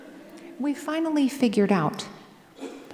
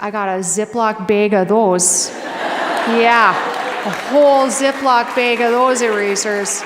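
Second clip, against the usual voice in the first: put-on broad Minnesota accent, drawn-out vowels; 6 dB louder than usual. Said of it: "Do you guys like my Minnesota accent".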